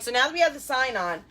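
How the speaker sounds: ordinary delivery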